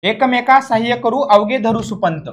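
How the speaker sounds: ordinary talk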